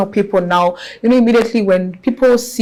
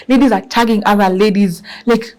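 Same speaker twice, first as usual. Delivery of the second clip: ordinary talk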